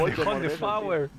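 high slow words through a laugh. Real, not acted. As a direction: crack up while saying it. Group laughing at names.

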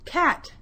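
"Cat" high, as one beat; syllable demonstration. Shown as a demonstration. Teacher has pupils feel the mouth open, "Cat" in one high beat. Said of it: "when your mouth opens and closes".

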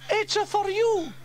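very high, loud little voice; squeaky Bella. Acted. Bella hands the fire chief the phone, squeaky.

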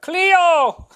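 high, loud shouted name; shrill fan shout from the back; yelled from the crowd. Retold or imitated. Crowd member yelling dog's name.

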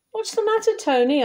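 put-on high-pitched child asking a friend; Tim. A narrator's reading. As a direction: ask up high, like a concerned child.

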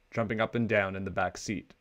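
ordinary delivery, nothing marked

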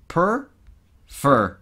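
Per and fer broken apart, each loud; syllables split. Shown as a demonstration. Teacher splitting prefer into loud syllables. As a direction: chop prefer into two hits. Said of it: "Imagínate que no existen".

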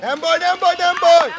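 shouted encouragement. Real, not acted. Coach tells players no harm done, shouted encouragement. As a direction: reassure players loudly from the sideline.

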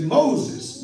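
calling out. Acted. God calls from the bush.

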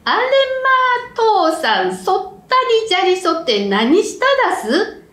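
high, surprised shout; startled cry. Acted. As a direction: cry out in shock. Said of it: "と叫びました".

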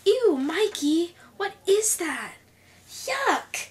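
high-pitched, drawn-out disgust; grossed out. Acted. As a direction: recoil from it with a rising yuck. Character recoils at something gross.

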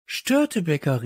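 high-pitched call of a name. A narrator's reading. Child on finding the parrot, calling out. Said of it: "rief ich".

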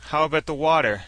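sarcastic jab, voice lifted. A narrator's reading. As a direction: toss out a pointed, mocking question. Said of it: "Hammond asked sarcastically".

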